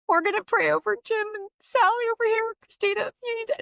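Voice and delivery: high, pleading church-lady gush; high, breathless retold plea; imitating Darla's tearful urgency. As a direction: gush it out like an emotional friend pulling you in to pray. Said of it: "and she'd be like".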